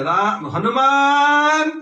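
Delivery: slow, high, loud summons; calling out. Acted. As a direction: drag the name out in a loud call. Ram calling Hanuman sharply.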